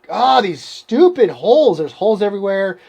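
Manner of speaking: retold frustration. Runner griping at holes in cow pasture, frustrated. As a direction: drag out the first gripe, then speed up. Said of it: "first time I felt frustrated".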